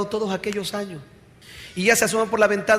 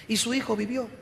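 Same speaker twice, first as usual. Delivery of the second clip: ordinary talk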